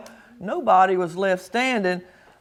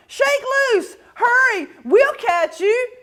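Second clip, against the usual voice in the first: shouting; 11 semitones higher than usual. Acted. Crowd shouting to Big Bark.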